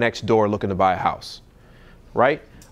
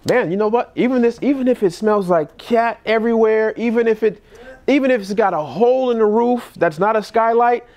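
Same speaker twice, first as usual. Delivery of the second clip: a bit higher, offhand and dismissive of the sale; lightly higher casual buyer voice; going negative; 7 semitones higher than usual. Shown as a demonstration. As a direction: talk the seller out of selling to you. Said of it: "I walk into a house and I'm like".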